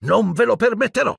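put-on high-pitched defiant boy; defiant exclamation. A narrator's reading. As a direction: burst out high, standing your ground. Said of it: "esclamò". (esclamazione acuta di sfida)

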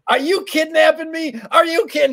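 high, loud hammed-up alarm; mock panic. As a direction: shriek it like a trapped old lady. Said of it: "she goes".

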